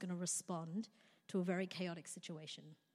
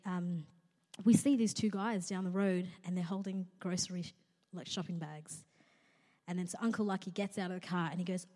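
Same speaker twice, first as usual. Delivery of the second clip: ordinary talk, nothing marked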